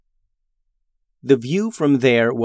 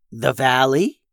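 ordinary delivery

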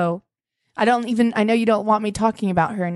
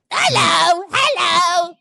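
high, loud spooky "hello" calls; a hammy ghost calling out from the closet; 9 semitones higher, 4 dB louder, much slower than usual. Retold or imitated. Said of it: "this hilarious ghost voice".

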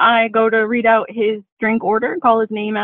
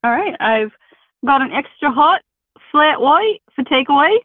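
amateur attempt at an Australian accent; 3 semitones higher than usual. Shown as a demonstration. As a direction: order coffee in a put-on Australian accent. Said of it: "quick little talk as Australians".

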